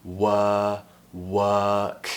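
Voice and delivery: "wor, work" drawn out with no R; non-rhotic work. Shown as a demonstration. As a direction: stretch "wor" then "work", R dropped. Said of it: "replacing it with an er sound".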